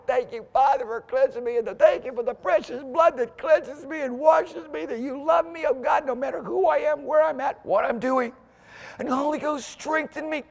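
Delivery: high, fervent prayer voice; demo prayer. Shown as a demonstration. Preacher modelling a heartfelt prayer for the church, fervent. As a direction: pray it out loud and earnest.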